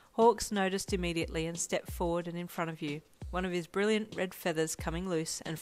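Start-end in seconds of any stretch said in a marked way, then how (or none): none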